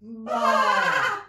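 loud high bleat; sheep bleating. Acted. Sheep Fleecy, Flossie and Flummox chatting in bleats. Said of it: "They said".

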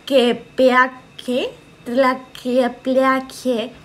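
"Tlaquepeaque" very slow, each syllable drawn out; slow syllable-by-syllable spelling out. Shown as a demonstration. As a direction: spell out "Tlaquepeaque" by ear, stretching it. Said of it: "Pronuncia esa ciudad". (repite "Tlaquepeaque" muy lento, estirando cada sílaba)

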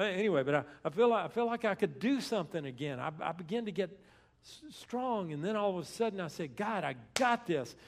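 charged-up confidence, raring to go; fired up. Retold or imitated. Preacher voicing David as he begins to get strong, fired up. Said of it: "hear how fired up he is".

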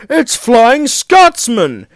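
slow, high, loud exclamation; gasped amazement. A narrator's reading. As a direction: awestruck at who has arrived. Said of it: "he gasped".

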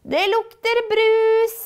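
high, loud and slow excited cry; little trolls crying out together in delight; excited shout. Acted. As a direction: shout it out in glee. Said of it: "roper de i kor".

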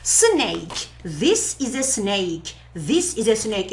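normal talking voice

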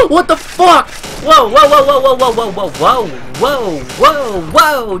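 very high loud whoas; real terrified screams; panicked screaming. Real, not acted. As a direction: shriek in terror. Monster attack, screaming.

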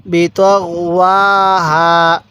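বিতগওয়াহা read slowly with each syllable clear; slow recitation demo. Shown as a demonstration. Teacher slowly models বিতগওয়াহা for students. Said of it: "কীভাবে পড়বেন?".

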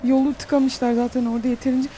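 normal talking voice

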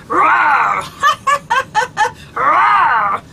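loud roaring laugh with rawrs; lion laughter. Pretend. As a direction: roar and laugh like a playful lion. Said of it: "do some lion laughter".

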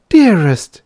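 high, loud cry of endearment; ardent cry. A narrator's reading. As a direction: gush it to your lover. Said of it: "she cried".

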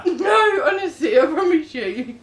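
laughing denial. Real, not acted. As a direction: swear it while laughing. Protesting through laughter.

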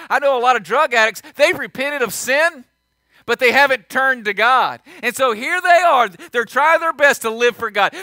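loud, driving sermon voice; impassioned preaching. Acted. Pastor pleading with the church about turning to God, loud and urgent. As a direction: press the point home, loud and urgent.

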